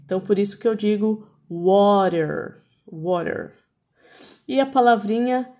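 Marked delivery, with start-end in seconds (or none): 1.5-2.6 drawn-out WATER, its T sounding as R; American T as R. Shown as a demonstration. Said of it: "ele tem som de R mesmo". 2.9-3.5 WATER with a tapped R in place of T; American T as R. Shown as a demonstration. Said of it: "ele tem som de R mesmo".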